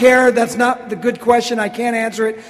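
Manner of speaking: loud comic brush-off; mock brusque. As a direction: cut them off with mock impatience.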